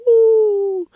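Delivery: high, loud excited "Woo"; hype whoop. Real, not acted. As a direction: whoop like you just preached a good one. Hyped by his own preaching.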